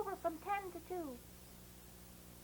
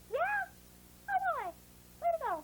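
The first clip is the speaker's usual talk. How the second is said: excited praise; 10 semitones higher than usual. Real, not acted. Teacher cheering her young class, high and excited.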